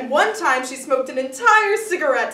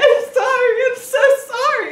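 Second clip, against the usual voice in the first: high, loud, hammed-up sobbing apology; mock bawling; 12 semitones higher, 8 dB louder than usual. Crying cashier apologizes to customer, mock bawling. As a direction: wail it like a tearful cashier, overdone. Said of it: "And she's bawling".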